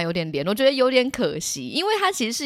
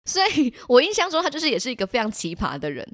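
usual speaking voice

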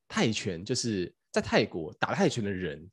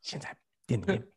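retold secretive whisper; 8 dB quieter than usual. Secret tip inside the shop.

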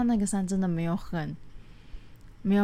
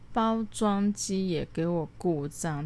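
normal talking voice

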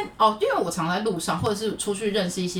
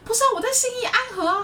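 exasperated retort; faster than usual. Retold or imitated. Retold exasperated reply.

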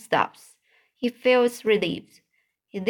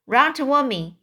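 raised, higher-pitched call; mild yell; 5 semitones higher, 4 dB louder than usual. A narrator's reading. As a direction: call him over, lightly acted. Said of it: "yelled the grinder".

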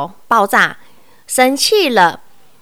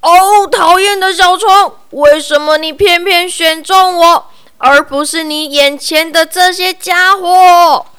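higher, louder grumbling bear; frowning complaint; 7 semitones higher, 8 dB louder than usual. Acted. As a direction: scold like a cross bear. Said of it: "皱着眉头说".

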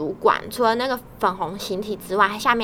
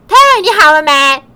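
calling out loud; 6 semitones higher, 13 dB louder than usual. Retold or imitated. Coworker calls across the office.

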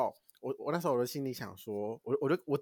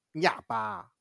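mock angry scolding; 7 semitones higher, 4 dB louder, slower than usual. 心里骂孩子.

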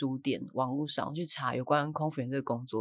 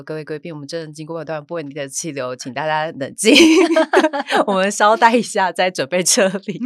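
louder, formal cabin PA announcement, laughing partway; flight announcement voice; 2 semitones higher, 11 dB louder than usual. Retold or imitated. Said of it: "然后我就突然说".

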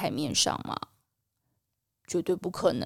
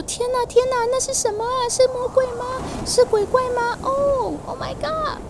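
much higher, panicked cries of alarm; panicked crying out; 13 semitones higher than usual. Acted. Disciples on the boat cry out at a ghost, panicked. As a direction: shriek it like you've seen a ghost. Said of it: "很惊慌的在喊叫说".

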